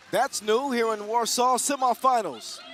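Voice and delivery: hyped announcer. Real, not acted. Commentator reacting to the opening gong, hyped call. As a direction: sell the moment like a big arena call.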